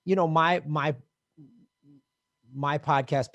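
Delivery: ordinary delivery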